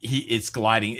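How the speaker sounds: usual speaking voice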